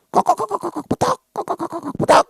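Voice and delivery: very high, rapid squawking chatter; mock bird-like nagging; chattering myna bird. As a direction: cluck and squawk like a talking bird. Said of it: "mertua saya kayak burung beo".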